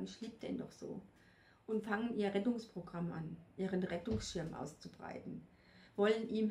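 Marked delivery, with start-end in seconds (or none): 0.0-1.0 whispered plea. Retold or imitated. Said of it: "Ganz viele Frauen stehen vor mir und sagen".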